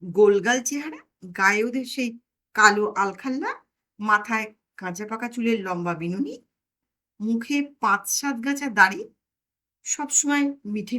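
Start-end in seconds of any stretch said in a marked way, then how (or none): none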